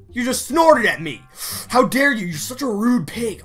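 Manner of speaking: loud, high indignant yelling at a pig; outraged scolding of a pig; angry yell. Pretend. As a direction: tell off the rude pig furiously.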